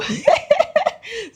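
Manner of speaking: a sudden high burst of laughter; high laughing burst. Real, not acted. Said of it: "God, I beg you".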